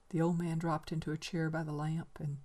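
ordinary talk, nothing marked

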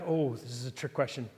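low, soft murmur; hushed, mock-deep musing; imitated pondering students. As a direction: play students privately puzzling over a trick question. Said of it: "all of us were like".

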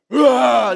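loud, high rallying roar; explosive "rah" shout; team roar. Retold or imitated. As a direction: imitate a team breaking huddle with a yell. Wrestling team's shout after prayer.

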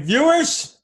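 put-on Indian accent. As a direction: call out like a newsreader. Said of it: "I could see him totally saying".

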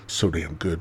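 normal talking voice

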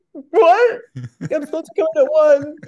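mock crying, high and loud, words breaking; hysterical sobbing. On the phone to the studio, loud mock sobbing. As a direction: wail it out like a wrecked, late actor. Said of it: "So I'm hysterically sobbing".

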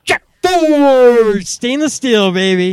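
high, slow, loud ad-announcer hype; toy commercial. Pretend. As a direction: sell it like a cheesy ad man. Mock ad tagline.

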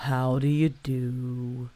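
low, slow, gloomy donkey greeting; a mopey old donkey saying hello. Acted. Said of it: "in a gloomy manner".

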